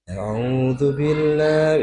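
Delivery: high, very slow drawn-out sighing chant; sighing, sultry recitation. Shown as a demonstration. Teacher showing women what to avoid, sighing recitation. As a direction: draw it out in a high seductive sigh. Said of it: "jangan suara sing mendesah".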